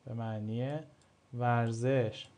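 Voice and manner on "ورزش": ordinary talk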